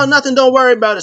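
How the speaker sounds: high, rapid retold brush-off; hurried brush-off.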